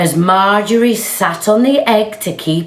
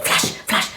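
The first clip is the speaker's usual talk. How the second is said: whispered camera flashes; 3 dB quieter than usual. Acted.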